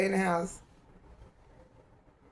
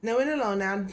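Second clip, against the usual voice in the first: usual speaking voice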